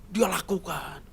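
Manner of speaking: ordinary talk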